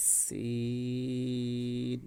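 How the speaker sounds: সিন drawn out very slowly and clearly; Arabic letter demo. Shown as a demonstration. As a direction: stretch সিন out long like a Quran teacher. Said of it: "আবার বলি". (ধীরে টেনে সিন উচ্চারণ)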